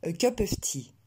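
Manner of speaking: French accent in English; accent français. Shown as a demonstration. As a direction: read it with a French accent, no puff of air on "tea". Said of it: "je vais vous le lire avec un accent français". (accent français marqué)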